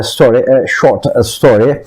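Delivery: usual speaking voice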